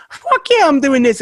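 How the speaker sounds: high-pitched eager yes; excited acceptance. Acted. Artist accepting a big radio show, excited. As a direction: jump at it, voice shooting right up.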